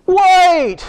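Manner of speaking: startled shout of recognition; sudden realization. Retold or imitated. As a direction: cry out as it dawns on you. Disciples realizing, calling out.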